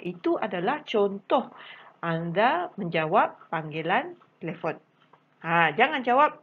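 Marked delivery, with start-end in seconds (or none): none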